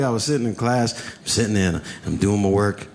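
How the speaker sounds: ordinary delivery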